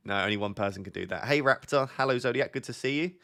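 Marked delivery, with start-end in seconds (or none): none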